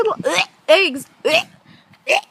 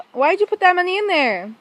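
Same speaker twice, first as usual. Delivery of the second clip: normal talking voice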